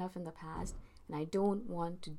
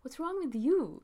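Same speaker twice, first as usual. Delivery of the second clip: higher, louder and slow, stress on you; pointed retort, higher and louder; turning it back; 9 semitones higher, 6 dB louder than usual. Retold or imitated. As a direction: throw it back at them, punching you. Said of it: "I think to myself".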